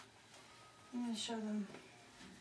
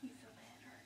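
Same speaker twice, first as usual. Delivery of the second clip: soft whispered question; 4 semitones lower, 36 dB quieter than usual. Real, not acted. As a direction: whisper it gently.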